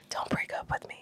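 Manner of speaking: very soft, voiceless whisper; mock whispered plea. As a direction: whisper a joking plea to a partner. Mock plea after skip-this-movie joke.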